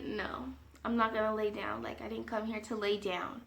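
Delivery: low, hushed, unimpressed refusal; deadpan no, very soft and low; quiet refusal. Retold or imitated. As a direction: refuse softly, voice dropped right down. Acts out her firm quiet refusal.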